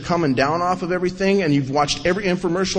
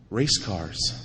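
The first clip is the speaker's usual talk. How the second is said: fading to a whisper; 8 semitones lower, 13 dB quieter, slower than usual. Retold or imitated. Fading chant of sponsor.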